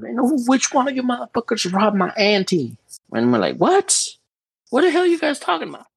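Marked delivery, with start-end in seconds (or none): 0.0-2.8 put-on high-pitched threatening yell at the boys; mock yell. Thug yelling at the kids about his auntie, mock yell. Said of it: "they start yelling at us". 3.6-5.9 shocked. Retold or imitated. Shocked reply to robbers.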